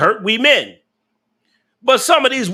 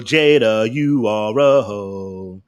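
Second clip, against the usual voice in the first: drawn out very slowly and lower; slow emphatic verdict; 9 semitones lower, much slower than usual. Acted. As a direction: stretch every word like a final ruling. Drawn-out verdict addressed to Jada.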